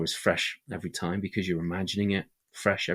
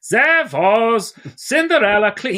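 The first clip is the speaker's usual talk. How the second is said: very high, loud hammed-up German accent; mock German accent; 13 semitones higher, 13 dB louder, slower than usual. Shown as a demonstration. Storyteller showing why not to do Grimm tales so, mock German accent. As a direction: bellow it in a cartoonish German accent. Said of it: "we don't do German accents".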